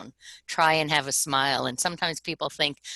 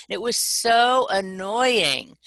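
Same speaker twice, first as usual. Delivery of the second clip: loud, drawn-out complaint; exasperated; 5 semitones higher, 5 dB louder, much slower than usual. Real, not acted. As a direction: stretch "so annoying" out, higher and louder. Exasperated complaint after the imitation.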